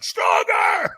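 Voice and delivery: very high, strained full scream; acted all-out drill-coach scream; screamed demand. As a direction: scream it right in someone's face. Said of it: "screamed at me".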